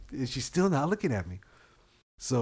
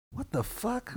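high, incredulous question; disbelief; 12 semitones higher, 4 dB quieter, slower than usual. Retold or imitated. Me seeing the maskless lady walk in, high disbelief. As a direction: act out your own shock, pitched up. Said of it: "Even I was like".

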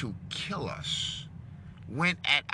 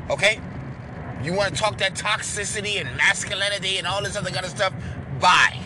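loud brush-off; 5 semitones higher, 4 dB louder than usual. Real, not acted.

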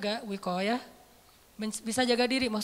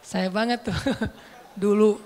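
laughing; 4 dB louder than usual. Real, not acted. Laughs at own confession.

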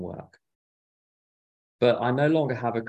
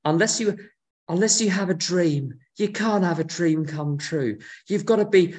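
preachy worldly advice; 5 semitones higher than usual. Retold or imitated. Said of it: "You're told by the world".

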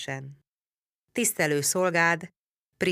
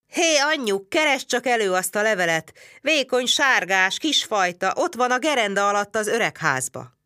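higher, louder, puffing outrage; blustering; 7 semitones higher, 4 dB louder than usual. A narrator's reading. Csát calls his wife to fetch the letter, loud higher bluster. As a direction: storm at them like a gale. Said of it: "mintha szélvész volna belezárva".

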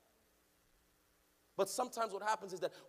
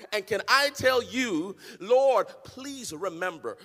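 loud, chanted preaching over the organ, slower on the pleas; preaching at full force; 6 semitones higher, 21 dB louder than usual. Acted. Pastor urging the congregation to pray boldly, loud chanted preaching. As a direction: climb into a sung, shouting sermon climax.

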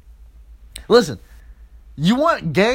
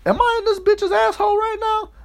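way up high in a squeaky put-on voice; sudden falsetto disbelief; high mock squeal; 13 semitones higher than usual. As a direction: squeal it like a shocked cartoon. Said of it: "I'm like".

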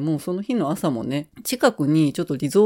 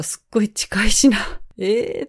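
excited; 5 semitones higher, 3 dB louder than usual. Retold or imitated. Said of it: "って言ってて".

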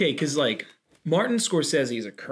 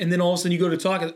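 ordinary delivery

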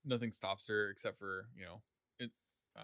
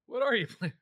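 high, loud sarcastic jab; mock the question sarcastically; 12 semitones higher, 7 dB louder than usual. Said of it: "really sarcastically".

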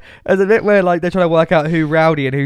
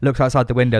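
ordinary delivery

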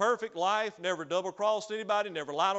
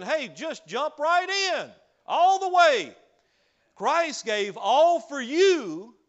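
louder, higher, ringing pulpit cry; full-force preaching; 4 semitones higher, 6 dB louder than usual. Acted. Preacher urging the congregation to jump right in, full-force preaching.

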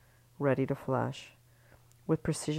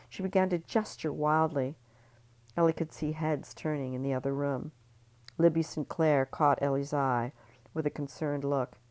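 ordinary delivery, nothing marked

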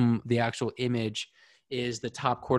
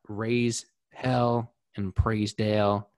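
slow sign-off; much slower than usual. Real, not acted. Signature sign-off phrase.

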